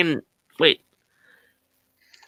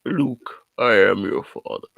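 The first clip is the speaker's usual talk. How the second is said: James Earl Jones; 9 semitones lower than usual. Acted. For friends, low Vader voice impression. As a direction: lower Darth Vader father line. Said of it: "James Earl Jones, bro".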